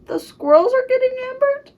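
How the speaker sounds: high, loud, incredulous question; shocked disbelief. Real, not acted. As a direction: cry it out in real alarm. Shocked reaction while reading aloud.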